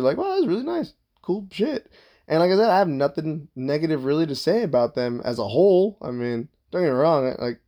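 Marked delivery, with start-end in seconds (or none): 0.2-1.9 mock wide-eyed wonder.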